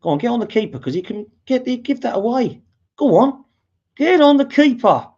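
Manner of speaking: rising to a pleading shout at the player; urging on. Real, not acted. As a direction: yell him onto the keeper. Fan urging player to press.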